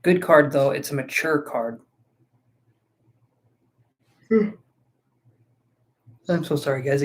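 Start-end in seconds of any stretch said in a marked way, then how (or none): 4.3-7.0 yawning. Real, not acted. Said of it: "I keep yawning".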